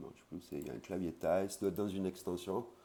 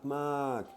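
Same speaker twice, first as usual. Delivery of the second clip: "mak" pitched high and loud; very far emphasis; emphatic mak; 8 semitones higher, 5 dB louder than usual. Shown as a demonstration. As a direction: hit the second "mak" high. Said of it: "ça veut dire très, très loin".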